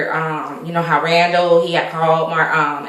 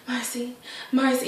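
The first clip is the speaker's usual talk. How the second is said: hushed plea; 3 semitones higher, 13 dB quieter than usual. Retold or imitated. Alex pleading quietly with Marcy.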